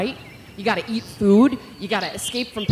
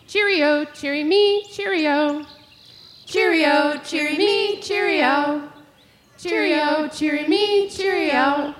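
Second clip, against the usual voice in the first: higher sing-song "Cheerio, cheery me"; robin song; 6 semitones higher, 4 dB louder, slower than usual. Shown as a demonstration. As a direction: lilt it like a robin. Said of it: "So we're gonna say".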